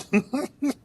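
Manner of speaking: high giggle at own comeback; laugh. Real, not acted. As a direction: break into a high chuckle.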